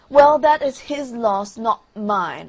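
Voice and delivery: loud, slow, lofty pronouncement; self-important firework sniffing; haughty rocket. Acted. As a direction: look down on the frog from a great height. Rocket boasts to absent frog.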